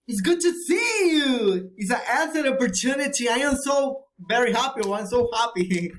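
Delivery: high, excited exclamations. Shown as a demonstration. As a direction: exclaim it high, as if thrilled to meet someone.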